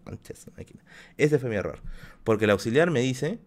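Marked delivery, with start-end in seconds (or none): 0.0-0.8 an unvoiced swear under his breath; whispered curse. Real, not acted. Cursing his own mistake to the stream, in a whisper. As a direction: hiss it under your breath.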